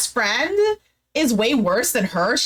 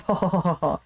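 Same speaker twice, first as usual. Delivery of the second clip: mock "oh-ho-ho-ho" laugh; imitated laughing; 6 semitones lower, 3 dB quieter than usual. He teases her with why not, imitated laugh. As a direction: act out a teasing chuckle, lower. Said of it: "And she's, like, giggling".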